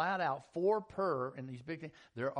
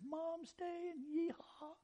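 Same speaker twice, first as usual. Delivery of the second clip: yeehaw cheer; 4 dB quieter, slower than usual. Acted.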